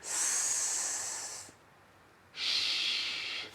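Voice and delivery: S and sh hissed with air only; just air, no voice; S, sh breathed softly; voiceless demo sounds. Shown as a demonstration. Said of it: "Try it".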